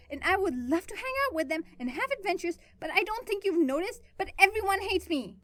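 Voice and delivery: high, loud furious rant; almost screaming. A narrator's reading. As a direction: scream it at him, near tears. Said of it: "she was almost screaming now".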